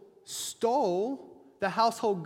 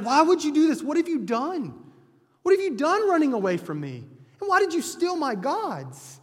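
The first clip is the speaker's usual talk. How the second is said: higher, louder retold angry questions; frustrated angry outburst; 7 semitones higher, 4 dB louder than usual. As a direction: voice a frustrated Jacob accusing Laban, raised pitch. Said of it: "So Jacob is frustrated and angry".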